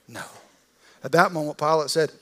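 ordinary talk

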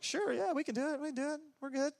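very high breezy mock confidence; cocky disciples. Disciples assuring the father, high mock confidence. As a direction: brush it off like overeager students.

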